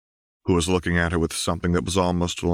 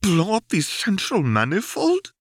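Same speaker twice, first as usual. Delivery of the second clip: hollow echo; 3 dB louder than usual. A narrator's reading. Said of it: "Karina echoed hollowly".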